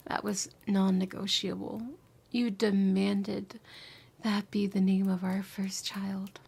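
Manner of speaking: soft confessing whisper, close to the ear; tender whisper. Acted.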